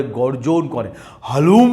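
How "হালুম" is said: mock tiger roar. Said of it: "tiger যে আওয়াজটা করে".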